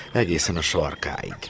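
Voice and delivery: usual speaking voice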